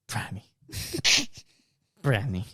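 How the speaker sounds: trying out a few voices on the word brownie; same word, a new voice each time; different voices. Retold or imitated. Trying voices from the bathroom floor. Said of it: "just in different voices".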